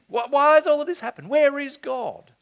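high, pleading whine of a doubting man; whining Gideon. Acted. Preacher mimics Gideon's complaint to the angel, high whining. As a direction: cry it out like a man who feels abandoned. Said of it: "says Gideon".

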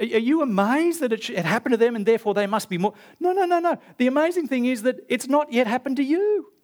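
high, astonished disbelief in the quoted question; incredulous what. Retold or imitated. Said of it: "Jesus says".

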